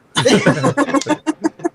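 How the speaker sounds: rising laugh, light and amused; high laugh. Real, not acted. Laughing at her own story about the pastor, amused. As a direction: let a laugh ride up high.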